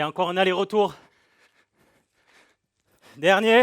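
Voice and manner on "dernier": loud call to push; shouted cue. Real, not acted. Coach calling out last rep.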